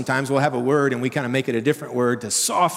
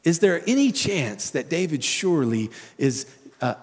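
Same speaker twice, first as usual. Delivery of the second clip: usual speaking voice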